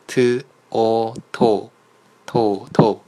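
teacher sounding out "t o to" with a puff on the t; drawn-out t and o, then joined as to; aspirated sound-by-sound demo. Shown as a demonstration. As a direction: slowly spell out t, o, then blend to aspirated to. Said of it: "第一个字是送气音的".

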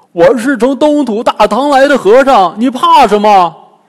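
high, very loud character voice; loud, boisterous Bajie. A narrator's reading. Bajie calls to the fleeing girl, loud and boisterous. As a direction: call out heartily after someone running off.

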